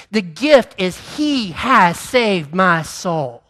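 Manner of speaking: loud, high fervent preaching. Acted. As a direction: preach it with full force. Preaching at full force.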